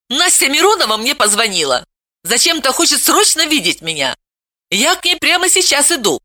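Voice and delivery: put-on a girl laying out her plan firmly; determined young girl. A narrator's reading. Veta proposing plan.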